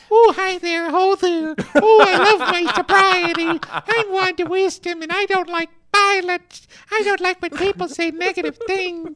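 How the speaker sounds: squeaky, very high mocking falsetto; sugary-innocent caricature, as if on helium; bad Wanda Wisdom impersonation. Co-host mocking Wanda to her face, exaggerated high impersonation. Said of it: "is that not the worst Wanda Wisdom impersonation".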